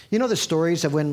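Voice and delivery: ordinary talk, nothing marked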